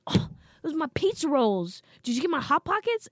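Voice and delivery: loud, high, indignant whine; mad brother. Retold or imitated. Brother scolds mom over snacks, mock angry. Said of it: "He was so mad".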